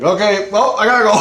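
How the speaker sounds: high, loud mock-nervous excuse to leave; nervous exit excuse. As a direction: blurt a hammed-up excuse to get out. Acted hasty excuse to leave.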